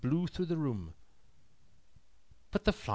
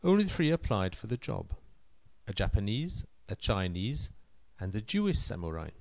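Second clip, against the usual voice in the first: ordinary delivery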